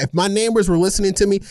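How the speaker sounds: ordinary delivery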